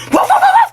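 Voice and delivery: high, yappy barks; dog barking. Pretend. Newborn barking at its mother like a dog. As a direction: bark like a little dog. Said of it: "Gusto mo tumahol ako?".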